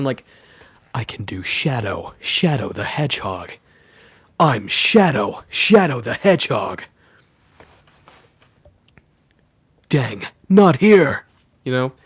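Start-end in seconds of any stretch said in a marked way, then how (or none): 0.9-11.3 Shadow the Hedgehog voice. Shown as a demonstration. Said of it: "the Shadow impression".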